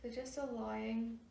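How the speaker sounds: soft, hushed meditation guidance; guided meditation. Real, not acted. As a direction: ease them into stillness, softly, with long pauses. Leads a meditation.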